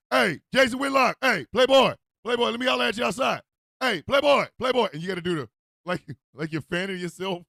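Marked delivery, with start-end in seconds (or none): 0.1-4.9 loud, raised call-out; acted street holler. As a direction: shout for his attention in short, falling bursts. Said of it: "if you want Jason, you gotta use the hand".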